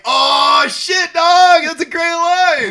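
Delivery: high, loud whoop of delight; excited shout. Retold or imitated. Fan reacting to a favorite movie line, delighted shout. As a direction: holler it with drawn-out relish. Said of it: "I'm like".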